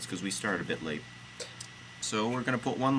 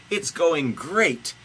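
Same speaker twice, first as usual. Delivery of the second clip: high, loud, drawn-out reply; God insisting; 8 semitones higher, 7 dB louder, much slower than usual. A narrator's reading. Host reading God reassuring Jesus, loud and slow. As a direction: belt it out slowly. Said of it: "say God".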